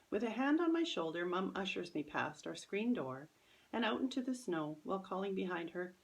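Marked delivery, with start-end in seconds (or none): none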